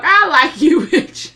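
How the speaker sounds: loud, high, laughing shout; laughing praise. Real, not acted. Laughing praise for Mickey at the screen, loud and high. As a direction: crack up while cheering her on. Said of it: "reacting, laughing".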